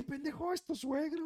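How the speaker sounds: high, startled thought; a shocked aside thought to himself; alarmed inner voice. Retold or imitated. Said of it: "Yo dije". (pensamiento alarmado, agudo y asustado)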